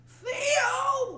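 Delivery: high drawn-out Theo call; Bill Cosby impression. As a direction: stretch out Theo, high and whiny. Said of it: "You gotta Theo into it".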